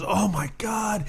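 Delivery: high, slow hammed-up groan of disbelief; mock dismay. Pablo seeing the real limit, high slow mock dismay. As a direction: draw it out like a caught man. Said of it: "Pablo goes".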